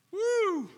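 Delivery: high whoop. Real, not acted. Preacher's exclamation.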